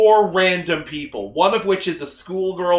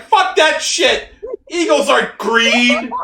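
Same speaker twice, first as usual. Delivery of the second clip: high, loud and slow; mock indignant outburst; 8 semitones higher, 7 dB louder, slower than usual. Host exaggerating friend's eagle objection, mock indignant shout. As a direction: blow it up into a hammed-up rant.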